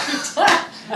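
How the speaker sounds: short, high burst of laughter; real laugh. Real, not acted. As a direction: laugh at your own joke. Laughs at her joke about ex.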